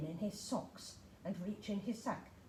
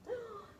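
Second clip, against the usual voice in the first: sudden gasp; 12 semitones higher, 7 dB quieter than usual. Acted. Gasp as elf vanishes.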